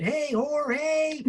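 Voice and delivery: imitating a Texas caller; Texas accent. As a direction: put on a twangy Texas hello. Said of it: "with a strong Texas accent".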